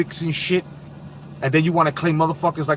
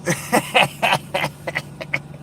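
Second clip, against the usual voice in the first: wheezing breathless laugh; 3 semitones higher, 3 dB quieter than usual. Real, not acted. Laughing at joke.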